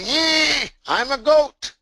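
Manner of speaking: put-on very high, childlike cub voice; innocent lion cub. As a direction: squeak it out like a naive little cub.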